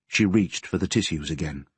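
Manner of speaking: ordinary delivery, nothing marked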